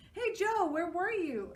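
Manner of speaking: put-on high loud kid call. A narrator's reading. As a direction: call across to Joe, high. John calls out.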